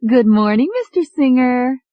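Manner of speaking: lilting secretary. Acted. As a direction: sing the greeting brightly. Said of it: "There was a lilt in her voice".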